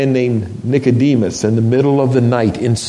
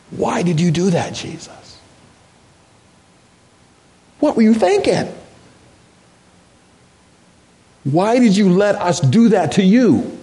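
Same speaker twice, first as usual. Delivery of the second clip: a touch of tearful pleading; mild tearful prayer; 8 semitones higher than usual. Retold or imitated. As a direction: retell the broken prayer to Jesus. Retold tearful prayer to Jesus.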